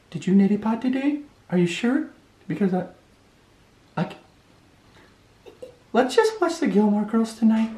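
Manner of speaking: wife voice, doubtful and stalling; suspicious wife voice. Retold or imitated. Husband mimicking his wary wife asking about the neti pot, high and soft. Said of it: "it goes something like this".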